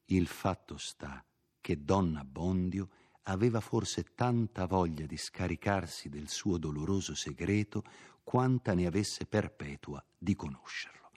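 ordinary delivery

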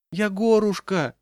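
put-on high, piping old-woman plea; plaintive old woman. A narrator's reading. Old woman calling from the thick of the crowd, plaintive. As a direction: squeak it pitifully. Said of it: "жалобно пискнула из гущи её какая-то старушка".

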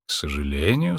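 low, careful delivery; slowly, regretful. Acted. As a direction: break it gently, low and measured. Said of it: "сказала доктор медленно". (медленно, с сожалением)

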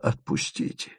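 whispered plea to be let go; pleading whisper. A narrator's reading. Asen begs the travellers to release him, whispered. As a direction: breathe it, begging. Умоляюще поглядел.

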